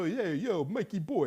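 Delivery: light New Jersey accent, imitating a colleague; a casual New Jersey "Hey, yo". Imitating a New Jersey coworker greeting him, light New Jersey accent. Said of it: "he talks like this".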